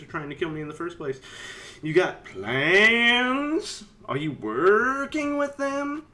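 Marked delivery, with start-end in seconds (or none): 1.8-6.1 slow, high, drawn-out accusing questions; mock suspicion. Player grilling the tinker, slow mock suspicion. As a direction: stretch it out like a paranoid detective.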